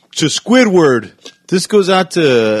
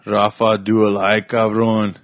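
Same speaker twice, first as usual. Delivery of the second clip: usual speaking voice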